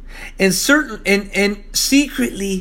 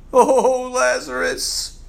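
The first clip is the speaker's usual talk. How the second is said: mock weeping; 7 semitones higher, much slower than usual. Mary weeping at the tomb.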